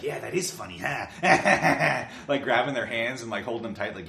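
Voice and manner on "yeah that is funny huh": mock laughing hero. Hero laughing with the girl afterwards, in a mock rough voice. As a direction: chuckle through the line as the rough hero.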